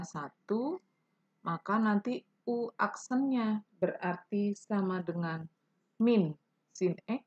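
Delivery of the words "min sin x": usual speaking voice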